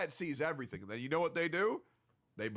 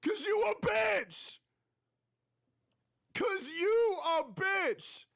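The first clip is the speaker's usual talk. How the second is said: high, slow screaming; screamed insult; 14 semitones higher, 3 dB louder, much slower than usual. Acted. Streamer screaming at a hater on a podcast, drawn out. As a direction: howl it out, dragged. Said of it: "yelled insult repeated".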